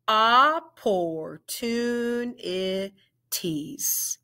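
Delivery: drawn out syllable by syllable; slow, segmented "Opportunities"; very slow model word. Shown as a demonstration. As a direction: stretch "Opportunities" slowly into its five syllables. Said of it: "Say it with me".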